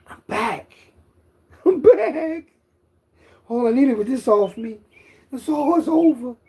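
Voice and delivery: high, loud triumphant crowing; exultant boasting. Real, not acted. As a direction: crow it like a big comeback.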